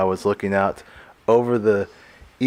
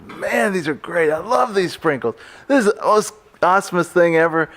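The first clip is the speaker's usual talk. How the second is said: put-on higher, eager young self; a hint of excited kid; 9 semitones higher than usual. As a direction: gush about sprinkles like a kid. Retold childlike self.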